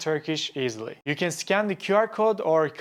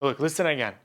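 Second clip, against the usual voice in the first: ordinary delivery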